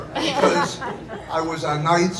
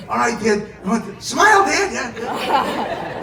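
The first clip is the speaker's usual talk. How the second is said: coaxing daughter voice; 8 semitones higher, 8 dB louder, slower than usual. Retold or imitated. Daughter coaching on stage.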